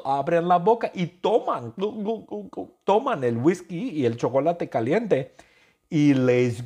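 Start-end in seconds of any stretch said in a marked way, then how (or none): 1.8-2.5 gulping sounds. Pretend. People gulping drink.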